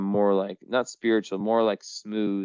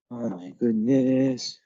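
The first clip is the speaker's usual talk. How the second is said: ordinary talk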